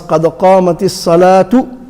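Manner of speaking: الصلاة ending in an audible "tu"; harakat on ta. Shown as a demonstration. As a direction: say الصلاة as "salaatu", running on. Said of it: "To place a harakat".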